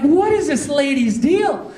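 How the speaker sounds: high, drawn-out exasperated outburst; worked-up, high-pitched retold bafflement; acted exasperation. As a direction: complain in baffled mock outrage. Exasperated reaction in a retold quarrel.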